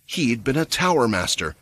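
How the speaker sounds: ordinary delivery, nothing marked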